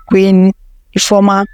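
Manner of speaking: plain reading voice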